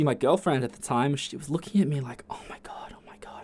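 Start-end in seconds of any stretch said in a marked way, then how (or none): 2.3-3.4 silent, breathy whisper of alarm; urgent hushed oh my God; mouthed whisper. Retold or imitated. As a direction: mouth it so they can't hear.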